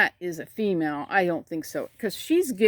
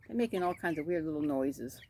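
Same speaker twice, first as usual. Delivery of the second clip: ordinary talk, nothing marked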